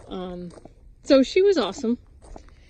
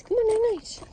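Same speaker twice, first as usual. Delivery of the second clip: much higher sing-song coax; 12 semitones higher than usual. Real, not acted. Woman coaxing a chicken to bed in baby talk.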